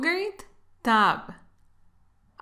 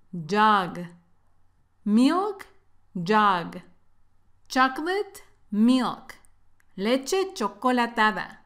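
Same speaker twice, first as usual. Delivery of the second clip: normal talking voice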